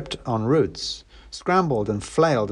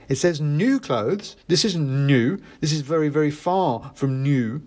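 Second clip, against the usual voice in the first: put-on high, protesting kid; whiny teen objection; indignant boy; 6 semitones higher than usual. A narrator's reading.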